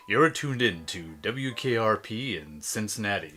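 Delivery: low, soft late-night DJ purr; sexy radio voice. Shown as a demonstration. Co-host doing the requested sexy radio voice on air. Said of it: "the sexy voice was like".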